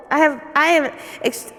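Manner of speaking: ordinary talk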